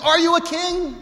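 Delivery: high, loud incredulous question; Pilate's question. Acted. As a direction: ask it like a sceptical governor.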